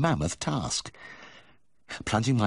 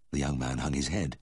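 ordinary delivery

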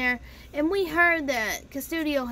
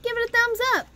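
"thumbs up" jumps way higher, pleading; high, insistent push on "thumbs"; emphatic high plea; 10 semitones higher, 3 dB louder, much faster than usual. Shown as a demonstration. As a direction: beg for the like, voice leaping up. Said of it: "Even if you didn't like it".

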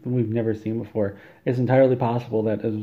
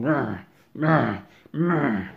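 grunted slashing sounds; imitated cutting noise; 4 semitones higher, much slower than usual. Surgeon hacks into his patient, imitated cutting noise. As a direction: make a separate grunt for each mock slash.

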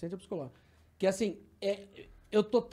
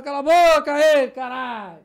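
high, very loud yell, slowed; retold angry shout; acted heckler from the back; 6 semitones higher, 12 dB louder, slower than usual. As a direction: holler it back like some other passenger. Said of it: "Fingindo que era outro lá de trás".

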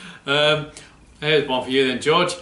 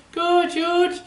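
much higher, squeaky puppet; 12 semitones higher than usual. Acted. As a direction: chirp it like the puppet dog. Puppet George says goodbye.

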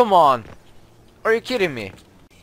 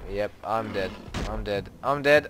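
ordinary talk, nothing marked

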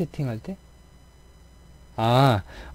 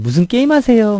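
put-on high, loud Busan-accented question; a Busan player asking cheerfully; Busan gamer; 9 semitones higher, 5 dB louder than usual. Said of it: "막 이런". (높고 큰 부산 억양 질문)

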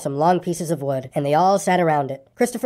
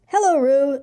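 bear voice; 7 semitones higher, slower than usual. A narrator's reading. Pooh voiced in story.

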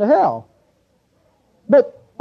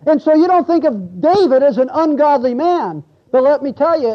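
ordinary talk, nothing marked

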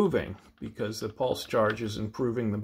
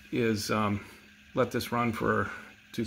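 ordinary talk, nothing marked

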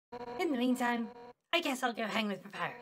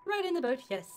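river person voice; 5 semitones higher than usual. Acted.

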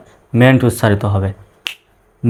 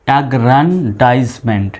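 slow, syllable-by-syllable অ্যাগ্র্যান্ডাইজমেন্ট; অ্যাগ্র্যান্ডাইজমেন্ট split into separate syllables for learners; broken into syllables; slower than usual. Shown as a demonstration. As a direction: say অ্যাগ্র্যান্ডাইজমেন্ট slowly, part by part. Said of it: "syllable অনুসারে ভেঙে ভেঙে উচ্চারণ করব".

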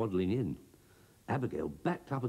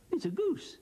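much higher delighted exclamation; excited cry. A narrator's reading. Broomstick seeing the goose leave the crate, excited cry. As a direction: cry out in surprise at the bird. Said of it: "cried Broomstick".

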